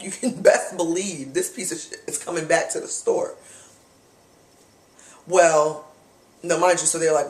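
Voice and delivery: ordinary talk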